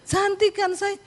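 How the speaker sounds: high-pitched, coquettish question; playfully acted vanity; coy showing off. Wife showing off new hair.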